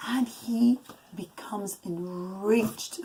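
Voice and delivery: slow, hushed storyteller whisper; dramatic whisper. A narrator's reading. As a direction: lean in and whisper the danger. Storyteller builds tension for children.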